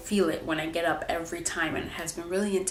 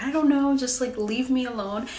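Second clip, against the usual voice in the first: higher, louder mock snap at the husband; snapping back; 5 semitones higher, 4 dB louder than usual. Wife brushing off her husband while upset, imitated snappy retort. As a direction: push him away, a light imitation of her tearful self. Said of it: "And it's like".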